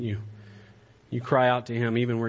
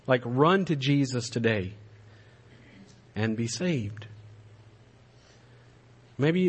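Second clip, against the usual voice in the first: normal talking voice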